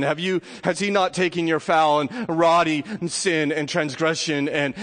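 usual speaking voice

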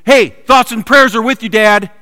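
sarcastic loud yell of empty comfort; mock shouted thoughts and prayers. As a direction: yell it as a hollow platitude. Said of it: "what if I would have just yelled".

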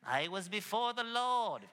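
lightly hammed-up pious reproach; a touch of sanctimonious mimicry; mock religious scolding. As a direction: put on a disapproving church voice. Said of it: "the hairy religious eyeball".

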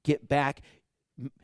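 ordinary talk

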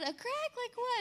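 high surprised "a crack?"; mock alarm, high; husband surprised. Husband's alarmed question quoted.

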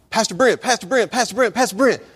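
put-on a teen running up, bursting to tell him; excited girl calling. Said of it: "you know, all excited and stuff".

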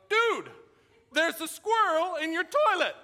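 high, loud, laughing yell across the house; excited shout. Retold or imitated. Calling to his friend in the kitchen, a loud laughing shout. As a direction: call out the gross discovery, half laughing.

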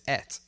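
ET rhyming with "bet"; low, soft "et"; British ate. Shown as a demonstration. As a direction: say ate as a short "et". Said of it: "I says et".

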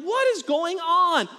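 high, exasperated demand; acted parental disbelief; bewildered dad. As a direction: cry out over the racket, baffled. Dad reacting to echo noise.

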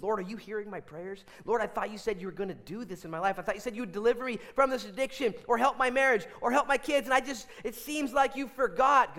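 acted desperate prayer, climbing as it goes; pleading prayer. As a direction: plead with the Lord, building in force. Voices a struggling believer's prayer.

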